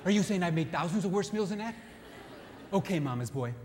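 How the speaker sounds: indignant wife snapping back; offended wife. Acted. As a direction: take offence and hit back. Acted wife's angry retort.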